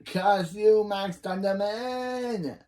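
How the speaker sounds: cartoonish vengeful wail; hammed-up villain curse. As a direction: curse your nemesis, stretching the name high. Acting grandpa's villainous curse.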